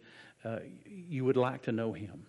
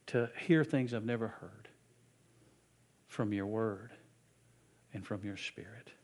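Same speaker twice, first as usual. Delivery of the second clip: prayer; 2 semitones lower than usual. Acted. Leads a prayer.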